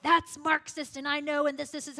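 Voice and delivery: hammed-up defensive outburst; mock defensive. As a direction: pounce on it, parodying an overeager objector. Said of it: "jump on the defensive every time".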